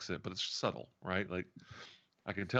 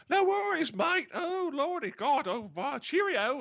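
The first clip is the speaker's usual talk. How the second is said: high, loud, hammed-up Australian; over-the-top mock Australian farewell; terrible Australian accent; 5 dB louder, slower than usual. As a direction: lay on the worst fake Australian, stretched out. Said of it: "the worst Australian accent I've ever heard".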